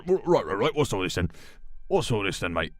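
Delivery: lightly British bobby voice; a hint of a cockney copper's "what's all this then"; British police officer. Officer greets George in a light British accent. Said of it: "a British police officer".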